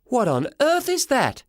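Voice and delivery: high, slow, drawn-out disbelief; high-pitched mother's astonishment; shocked mum. Acted. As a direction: pitch it way up, appalled. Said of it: "She said exactly the same thing".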